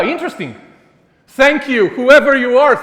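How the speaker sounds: normal talking voice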